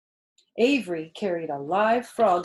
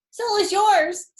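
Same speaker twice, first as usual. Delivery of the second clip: much higher, loud, slow retort; cried back; 14 semitones higher, 8 dB louder than usual. A narrator's reading. Avery crying back to Fern, high and loud. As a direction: fling it back mid-swing. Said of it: "cried Avery".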